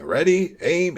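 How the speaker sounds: mock command to a firing line; imitated firing command. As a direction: call it out high like an order. Imitates someone calling firing commands.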